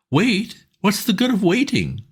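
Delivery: pleading pal, keen and urgent; eager Bingo. A narrator's reading. Bingo asking his old friend Bertie for help, eager and high.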